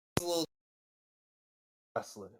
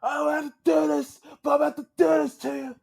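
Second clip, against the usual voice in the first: higher, louder, menacing taunt; gruff threat; 9 semitones higher, 5 dB louder than usual. Retold or imitated. Villain threatening the young wrestler, loud mock shout. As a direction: snarl a threat at the kid, speeding up at the end. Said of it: "So he's like".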